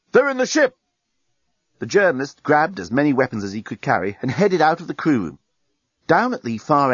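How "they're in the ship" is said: high-pitched warning cry; sudden yell. A narrator's reading. Dan spotting invaders from virtual reality, yelling. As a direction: yell out the alarm. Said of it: "suddenly yelled out".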